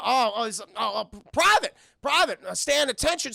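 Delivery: flustered sergeant. Retold or imitated. Paxton flustered calling attention.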